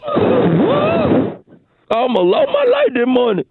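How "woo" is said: high shaky woo. Acted. Caller shaken after the fire.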